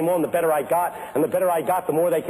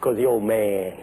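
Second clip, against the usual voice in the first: put-on old pastor; 12 semitones lower, slower than usual. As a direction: drop low and take your time, imitating an old man. Old pastor answers young preacher, imitated.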